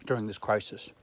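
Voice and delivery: talking while chewing; words round a mouthful of berry; mouth full. Real, not acted. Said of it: "talk with food in my mouth".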